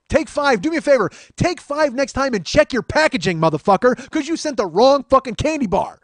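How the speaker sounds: high, loud indignant tirade; angry rant. Acted. Man scolding the seller and candy maker, angrily loud.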